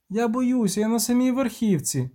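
put-on high-pitched child's complaint; little girl. A narrator's reading. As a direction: plead in a high girl's voice. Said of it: "захована в листі дівчинка".

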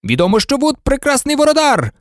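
high-pitched match commentator; Лі Джордан. A narrator's reading. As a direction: commentate with excited praise. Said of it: "коментував Лі Джордан".

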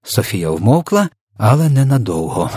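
ordinary delivery

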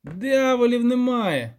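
high, slow, emphatic contradiction; scoffing denial. Acted. Poet contradicting the village elder, high and emphatic. Poet denies devils exist.

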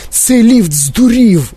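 high, slow outcry; yelling in alarm. A narrator's reading. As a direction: wail it out in panic. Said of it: "репетував дідунь Джо".

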